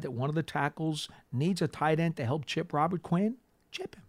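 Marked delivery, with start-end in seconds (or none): 3.7-4.0 quiet aside. Shown as a demonstration. Host to co-host on the edge rusher, a soft aside. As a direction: drop it in softly as an aside. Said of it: "host repeats the advice, first take".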